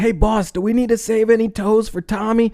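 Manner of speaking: high goofy henchman voice; squeaky dim-witted henchman asking the boss. Retold or imitated. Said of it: "It's like".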